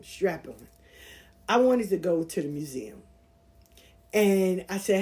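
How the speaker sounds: ordinary talk, nothing marked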